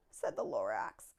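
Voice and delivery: ordinary delivery, nothing marked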